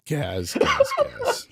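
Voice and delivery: laughing through the words; giggling while reading it out. Real, not acted. Reading embarrassing chat, laughing.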